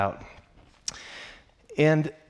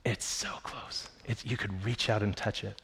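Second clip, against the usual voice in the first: breathy awed whisper, much softer; hushed whisper; 3 semitones lower, 9 dB quieter than usual. Real, not acted. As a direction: whisper it like a secret too close to say aloud. Awed hush about the kingdom.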